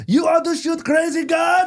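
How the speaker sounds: high, loud broken-English shout; belting out the offer in a foreign accent; Polish instructor voice. Retold or imitated. Instructor shouting an offer to tourists, high and loud. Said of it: "and he's like".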